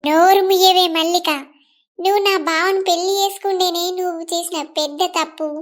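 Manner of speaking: ordinary talk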